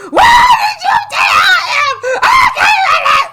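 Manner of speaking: high, loud, slow hysterical shout; mom screaming. Retold or imitated. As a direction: scream it like your mom lost it. Mother screaming on phone.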